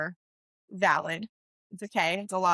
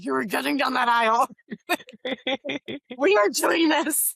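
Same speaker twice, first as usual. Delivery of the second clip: louder, higher, insistent no-nonsense push; firm pep talk; 5 semitones higher, 5 dB louder than usual. Retold or imitated. Planner telling the sobbing bride she's walking that aisle, insistent. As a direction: lay down the law, half laughing. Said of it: "I was like".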